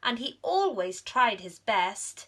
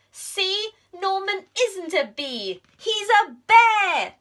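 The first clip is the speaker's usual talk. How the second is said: unmask him in triumph; triumphant reveal; 11 semitones higher, 10 dB louder than usual. Acted. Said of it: "said Amelia".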